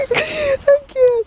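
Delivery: can't-handle-it squeal at the puppy; squealing laugh. Real, not acted. Overwhelmed by cute puppy.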